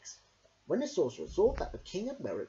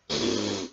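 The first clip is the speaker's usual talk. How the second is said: haughty sniff; 7 semitones lower, 6 dB louder than usual. A narrator's reading.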